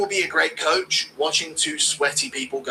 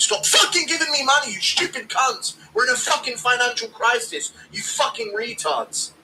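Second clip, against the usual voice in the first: mock anger; 9 semitones higher than usual. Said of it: "too many donos".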